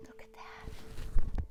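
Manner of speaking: hushed storytime whisper. Acted. Teacher whispering to the children at storytime. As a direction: whisper it so the truck sleeps.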